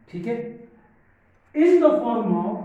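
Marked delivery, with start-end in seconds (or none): 1.5-2.6 loud, very slow dictation; emphatic dictation. Real, not acted. Teacher dictating notes to class, slow and loud. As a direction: draw it out for note-takers.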